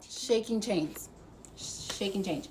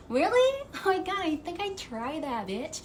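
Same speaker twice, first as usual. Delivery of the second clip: sarcastic; 7 semitones higher than usual. Real, not acted. Sarcastic retort to imagined viewers.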